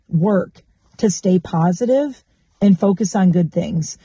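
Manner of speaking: ordinary talk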